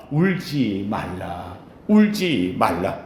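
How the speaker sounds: a low slow soft Jesus telling her not to cry; gentle hushed comfort. Acted. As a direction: hush her tears tenderly, drawing out each word. Jesus comforts the weeping widow.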